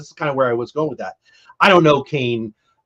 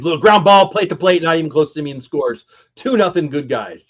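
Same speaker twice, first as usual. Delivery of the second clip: radio play call; 3 semitones higher, 4 dB louder than usual. Retold or imitated. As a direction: call the run like it's going out on air. Broadcaster reciting his radio call.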